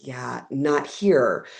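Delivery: very slow, deadpan no; dry correction. Retold or imitated. Local woman correcting the newcomer, slow and deadpan. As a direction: drag out the correction like a patient local.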